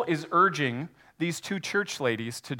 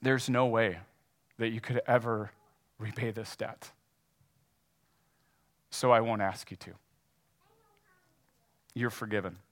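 quiet, lower, gently firm forgiveness; compassion and command; 4 semitones lower, 4 dB quieter than usual. Acted. Owner forgiving the broke customer, soft commanding compassion. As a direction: forgive the debt softly but with authority. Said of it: "with this voice of compassion and command".

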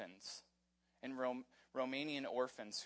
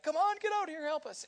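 high, loud holler for help; urgent call for help; 14 semitones higher, 5 dB louder than usual. Retold or imitated. Fishermen hollering to friends from a sinking boat, urgent call.

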